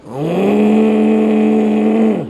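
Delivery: long loud nasal drone like snoring; loud snore. Retold or imitated. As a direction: imitate a snore. Imitating loud snoring sound. (meniru bunyi dengkur kuat)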